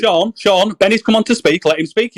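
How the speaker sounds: loud, firm interruption; loud cut-in. Real, not acted. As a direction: shout him down so Benny can talk. Shouting over to let Benny speak.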